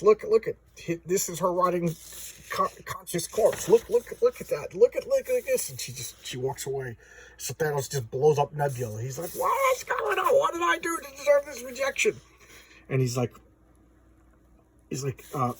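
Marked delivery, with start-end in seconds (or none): 0.0-5.7 high, urgent mock insistence; hammed-up frantic presentation; frantic showing off. Thanos frantically shows off corpse. 9.3-12.2 hammed-up wail of a rejected lover; tantrum wail. Spurned Thanos has a tantrum.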